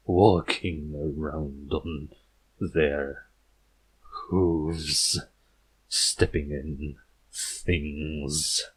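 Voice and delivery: a lightly thickened, gurgling creepy author, slow and low; thick gurgling voice. Acted. Uneasy horror author warning the princess, slow thick gurgle. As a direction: let the words gurgle a little as you warn her. Glubcraft shuddering at earth ponies.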